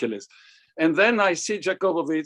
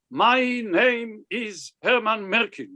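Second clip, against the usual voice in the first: imitating a forceful, self-important man; higher-pitched, emphatic mock introduction; Herman Merkin; 4 semitones higher than usual. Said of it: "I remember the way he was talking, the takif".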